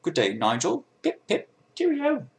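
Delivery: hammed-up British accent, leaping high; Nigel's British accent. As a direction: do a chirpy mock-British accent. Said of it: "This is my Nigel accent".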